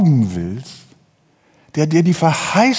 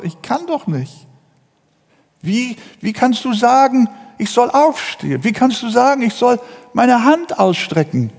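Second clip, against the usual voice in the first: higher-pitched, pleading objection; incredulous protest; 6 semitones higher than usual. Acted. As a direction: protest in disbelief as the helpless patient. Paralysed man protesting to Jesus.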